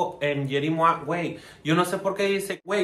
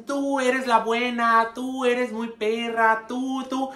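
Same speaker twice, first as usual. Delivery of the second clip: mocking flatterers; 7 semitones higher, slower than usual. Mocking what people tell her.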